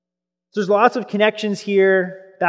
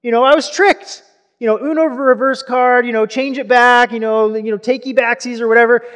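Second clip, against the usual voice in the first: higher, louder, hammed-up panic; mock protest; 4 semitones higher, 5 dB louder than usual. Imagining himself tricked and wanting it undone, mock protest. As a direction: playfully wave the mistake away. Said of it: "I'd have been like".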